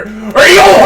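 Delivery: loud, high storybook pirate; hammed-up sea-dog growl. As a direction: belt out pirate noises. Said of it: "no way these Houthis are".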